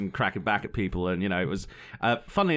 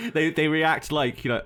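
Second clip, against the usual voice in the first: ordinary talk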